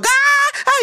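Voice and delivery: very high shrill mock screams; mock panicked screaming. Bystander screaming at a fire, mock panicked screaming. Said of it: "sumisigaw siya".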